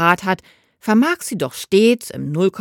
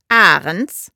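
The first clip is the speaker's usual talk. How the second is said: brisk, louder answer on the phone; mother picks up the phone, brisk and crisp; crisp woman's voice; 6 dB louder than usual. A narrator's reading. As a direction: snap your surname into the receiver. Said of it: "meldet sich eine schneidige Frauenstimme".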